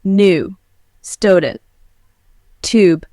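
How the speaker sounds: American accent, no Y glide in "new, student, tube"; American accent: "student" as "stoodent"; American accent U demo. Shown as a demonstration. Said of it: "In American English, we say".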